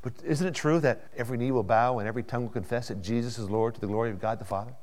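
usual speaking voice

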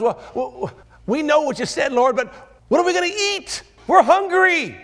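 high, loud complaining; hammed-up hungry disciples; whiny mock disciples. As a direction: whine about lunch.

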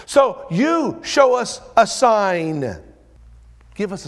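loud, high, dragged out; mock demand. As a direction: sneer their demand back at them. Mocking the Pharisees' demand.

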